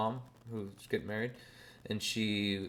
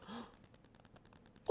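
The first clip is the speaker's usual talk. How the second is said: gasp; 23 dB quieter than usual. Retold or imitated. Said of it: "she goes".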